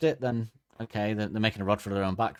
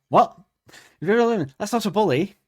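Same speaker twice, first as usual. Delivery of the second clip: much higher and louder, shocked; outraged disbelief; 10 semitones higher, 10 dB louder than usual. Real, not acted. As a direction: cry out in horrified disbelief. Outraged at the bully story.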